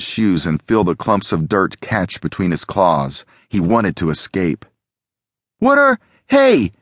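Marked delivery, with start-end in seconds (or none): 5.6-6.7 high, loud startled shout; Alex, shouting. A narrator's reading. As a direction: call out sharply in protest, pitched way up. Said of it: "Alex shouted".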